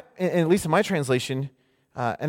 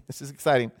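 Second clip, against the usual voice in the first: laughing; 3 semitones lower, 4 dB quieter, faster than usual. Real, not acted. Preacher laughing at own enthusiasm.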